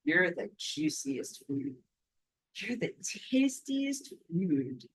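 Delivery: slow, hushed predatory voice; hungry vampires. Pretend. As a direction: savour each word like a hungry vampire. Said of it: "They're like".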